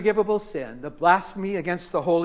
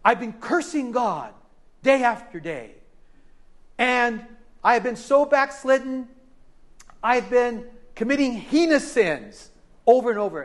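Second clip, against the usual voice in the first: put-on frightened young man; 6 semitones higher than usual. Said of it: "He said".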